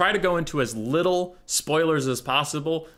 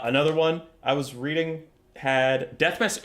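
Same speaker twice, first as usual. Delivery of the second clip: normal talking voice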